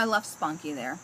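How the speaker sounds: ordinary delivery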